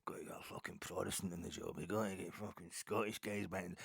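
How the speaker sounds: hushed, gravelly-voiced pub bigot, a sketch of one; muttered, knowing barstool tough-guy impression; mock bar-room hard man. Fan mocks pub hard men laying down the law, hushed. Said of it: "it's like".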